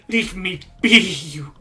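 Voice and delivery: frightened shout. Acted. Old woman shouting at a crow, frightened shout. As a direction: yell it at the creature.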